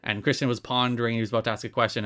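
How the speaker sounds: usual speaking voice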